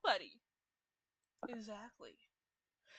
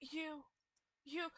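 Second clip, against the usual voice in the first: ordinary talk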